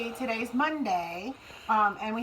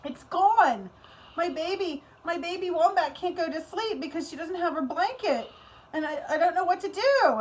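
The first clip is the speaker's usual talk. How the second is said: higher, worried little puppet voice; 7 semitones higher than usual. Acted. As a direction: sound lost and fretful, like a child whose toy is missing. Puppet upset about lost blanket.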